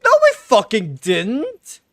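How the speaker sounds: a streamer yelling at the plot; exasperated rant. Real, not acted. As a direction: vent at the game's nonsense story. Ranting at the confusing story.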